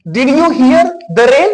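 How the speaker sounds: very slow, careful English question; deliberate teacher read; slow example question. Shown as a demonstration. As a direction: draw the question out for learners. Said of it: "दोनों का भाव clear हो रहा है?".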